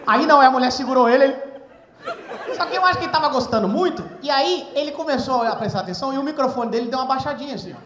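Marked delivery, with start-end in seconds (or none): none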